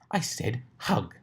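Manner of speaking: slow, firm repeat; insistent. Acted. Rarity ordering the pegasi to hug, slow firm order. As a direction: say it leaving no room.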